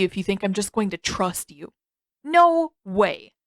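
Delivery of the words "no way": emphatic refusal. Acted. Sokka refusing to trust.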